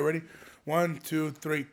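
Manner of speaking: ordinary talk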